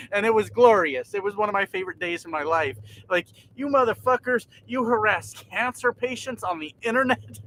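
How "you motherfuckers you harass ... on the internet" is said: higher, mock-triumphant jeer; gloating taunt. To the trolls who mocked his cancer, higher gloating taunt.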